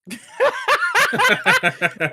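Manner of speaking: high loud burst of laughter. Real, not acted. Laughing at Jack's outro.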